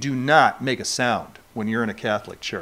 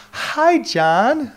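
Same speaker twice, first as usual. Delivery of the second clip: lightly voiced girl, higher; imitating Sue; 10 semitones higher, 4 dB louder than usual. Preacher imitating a girl greeting him at eighteen. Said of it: "she came up to me and said".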